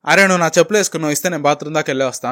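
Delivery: fast, casual retold ask; hurried request. Friend hurrying to the bathroom, fast casual ask. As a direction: rattle it off like you're in a rush.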